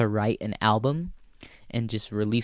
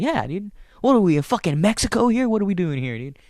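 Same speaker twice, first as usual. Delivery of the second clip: triumphant comeback; 8 semitones higher, 3 dB louder than usual. Retold or imitated. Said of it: "I'm just like".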